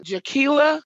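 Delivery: asking if it's her, in dread; alarmed question. Retold or imitated. Fears the body is Jaquila.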